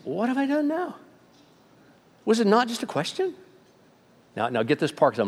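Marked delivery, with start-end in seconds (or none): 0.0-3.3 innocent protest. Retold or imitated. David protesting brother's accusation.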